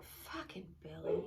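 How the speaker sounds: slow, soft irritated mutter; muttered under breath. Retold or imitated. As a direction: grumble it to yourself. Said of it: "under my breath even".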